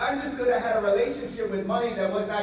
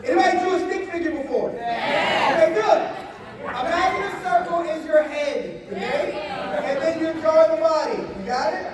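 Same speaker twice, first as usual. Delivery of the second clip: energetic; 8 semitones higher, 5 dB louder than usual. Real, not acted. Teacher energizing the class.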